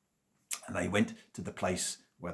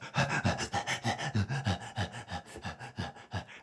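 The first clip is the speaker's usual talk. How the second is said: laugh; 2 semitones higher than usual. Real, not acted. Laugh alone.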